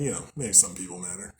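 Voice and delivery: normal talking voice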